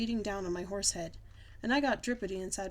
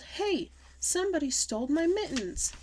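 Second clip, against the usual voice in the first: high-pitched alarmed outburst; outraged cry; 7 semitones higher, 4 dB louder than usual. A narrator's reading. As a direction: cry out at a sudden theft. Child discovers the theft.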